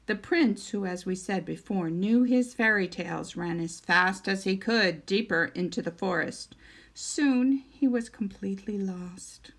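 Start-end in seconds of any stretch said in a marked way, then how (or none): none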